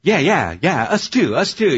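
loud, high followers piling on agreement; eager chiming in. Acted. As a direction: chime in loudly like the whole group. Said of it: "they all kinda chimed in".

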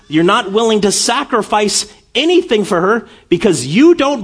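fervent preaching. Acted. Pastor urging the congregation about faith, in full fervent preaching. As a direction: drive it home to the congregation.

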